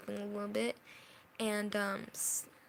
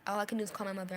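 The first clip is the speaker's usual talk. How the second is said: ordinary talk, nothing marked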